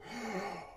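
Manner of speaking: sharp high intake of breath; breathy gasp of shock at the screen; mock gasp. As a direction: gasp as if the monster is about to strike. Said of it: "and you go".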